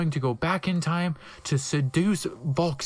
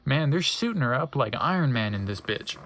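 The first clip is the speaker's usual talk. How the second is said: usual speaking voice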